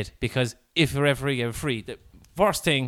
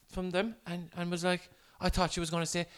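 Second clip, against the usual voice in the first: ordinary talk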